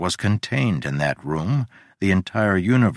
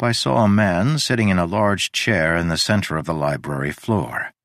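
usual speaking voice

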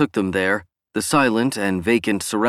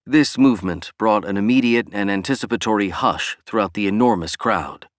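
ordinary delivery, nothing marked

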